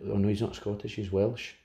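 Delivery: normal talking voice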